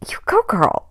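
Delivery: whispered cheer. Retold or imitated. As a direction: cheer her on under your breath. Cheering the friend leaving quietly.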